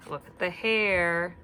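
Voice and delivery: ordinary delivery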